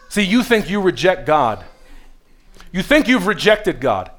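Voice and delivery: usual speaking voice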